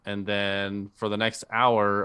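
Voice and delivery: ordinary delivery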